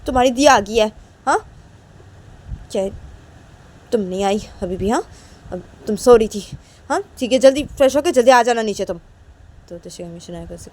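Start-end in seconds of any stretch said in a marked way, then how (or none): 0.1-9.0 a mother calling her daughter to come downstairs, raised voice. Acted. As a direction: call up the stairs like a busy mom.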